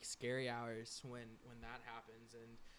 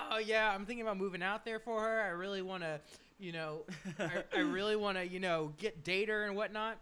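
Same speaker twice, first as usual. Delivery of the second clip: higher, louder dopey-guy impression of a coworker; mock lovesick coworker, voiced higher with a laugh slipping in; mocking Kyle; 7 semitones higher, 4 dB louder than usual. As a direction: ham up a clueless dude announcing his plan. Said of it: "Kyle was like".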